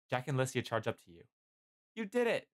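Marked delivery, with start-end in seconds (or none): 1.9-2.5 high-pitched cheer of victory; excited shout. A narrator's reading. Jack hails the victor as the battle ends, shouting. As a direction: call it out high, elated. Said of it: "Jack shouts".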